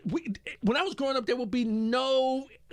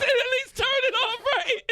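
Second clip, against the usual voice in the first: high, louder whine; whiny kid complaint; 14 semitones higher, 4 dB louder than usual. Retold or imitated. Son protesting dad yanking the plug, whiny. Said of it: "He said".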